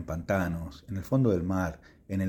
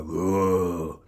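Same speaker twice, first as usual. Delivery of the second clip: friendly monster voice; 3 semitones lower than usual. Acted. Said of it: "el monstruo dijo fue".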